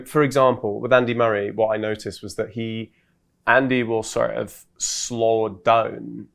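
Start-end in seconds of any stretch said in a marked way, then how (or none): 3.5-6.3 slow sentence ending. Shown as a demonstration. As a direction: draw out the end of the sentence very slowly. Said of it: "slow down at the end of a sentence like that".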